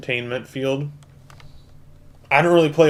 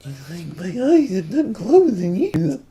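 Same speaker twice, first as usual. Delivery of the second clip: high, slow, creepy put-on voice; scary voice; 8 semitones higher, 4 dB louder, slower than usual. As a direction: creep the viewers out, drawn out high. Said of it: "That's my scary voice".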